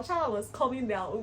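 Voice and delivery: ordinary talk, nothing marked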